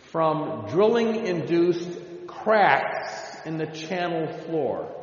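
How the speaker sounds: ordinary talk, nothing marked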